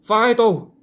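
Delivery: high loud call "Fido! Fido!"; calling a dog. Retold or imitated. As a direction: shout the dog's name across a field. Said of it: "they'll all think I'm going".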